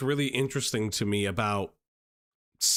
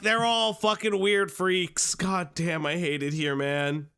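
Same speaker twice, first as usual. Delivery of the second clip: ordinary talk, nothing marked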